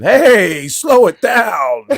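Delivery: slow, high drawn-out warning; mock advice. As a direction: stretch it out like a coach. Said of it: "The biggest advice Ken Patera ever gave".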